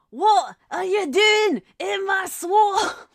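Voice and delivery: high, very loud, slow ogre bellow; drawn-out, outraged shouting impression; Shrek. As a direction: roar it in a cartoon Scottish ogre accent. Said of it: "a Shrek accent".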